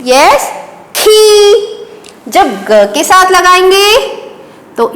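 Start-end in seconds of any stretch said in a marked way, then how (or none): 0.9-1.5 खी said with a breathy kh and a long ee, higher; teacher modelling खी for young pupils; model syllable. Shown as a demonstration. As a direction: lift and sustain खी as a clear example. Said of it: "इसका उच्चारण क्या बनेगा".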